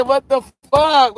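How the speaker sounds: high, loud, drawn-out cursing; exasperated outburst. Retold or imitated. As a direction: blow up in comic disbelief. Exasperated by child's endless pestering.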